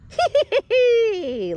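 high, loud hee-hee giggle; ghostly giggle. Acted. Three ghosties laugh at the ghoulies, high giggling. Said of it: "laughed the three little ghosties".

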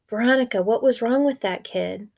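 ordinary delivery

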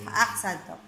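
usual speaking voice

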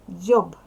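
Дзьоб very loud, with a voiced final B; loud, distinct Дзьоб ending in B, not P; clear voiced ending. Shown as a demonstration. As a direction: ring out the Б at the end of Дзьоб. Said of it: "Вимовляй чітко".

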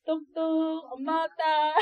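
slow, loud sly wolf voice at the door; wolf imitating mother. Wolf at the door fakes mom to the child, slow and loud. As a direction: drag out the knock like a sneaky wolf. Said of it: "엄마 흉내 내면서 들어올 때".

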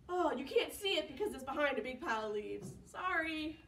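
ordinary talk, nothing marked

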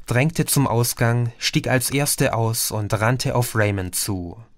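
ordinary delivery, nothing marked

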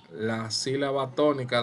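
usual speaking voice

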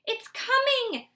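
mother's high, loud, slow excited gasp. Acted. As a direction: gasp it out in joyous alarm. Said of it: "Soren's mother gasped".

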